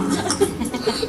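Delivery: airy, quiet chuckle; short soft laugh under the breath. Real, not acted. Speaker amused at her own retold story, laughing.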